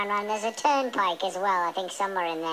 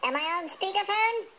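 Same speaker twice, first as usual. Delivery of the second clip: calling out; 5 semitones higher than usual. Acted. Testing speakerphone, calling out.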